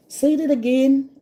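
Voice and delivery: puzzled ask to repeat. Retold or imitated. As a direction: call out over fast patois, confused. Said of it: "I hear my own Jamaican speak after ask".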